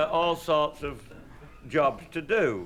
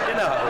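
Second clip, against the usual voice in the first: high, loud real laughter around a short aside; laughing fit; 13 semitones higher, 4 dB louder than usual. Real, not acted. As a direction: keep cracking up at the joke. Laughs at own punchline.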